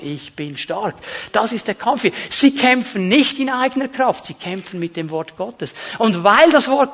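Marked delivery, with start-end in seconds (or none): 0.0-1.0 low, soft declaration of faith; subdued, lower-pitched affirmation; quiet assurance. Acted. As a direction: say it softly, as a settled truth.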